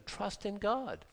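hushed and slow, falling at the end; slow quiet sincere urging; slow soft plea. Real, not acted. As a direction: draw it out gently, settling the point. Softly urging listeners to trust.

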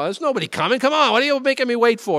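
high, loud road-rage griping; exasperated at the car ahead, voice climbing; mad driver. Retold or imitated. Driver fuming at someone stopped at a stop sign, imitated anger. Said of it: "I'm mad at people".

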